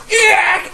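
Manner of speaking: short, high, loud yelp; imitated screech. Friend mimicking a classmate acting up, high loud screech. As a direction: squawk it out like a movie impression. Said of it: "He just starts doing this, and he's like".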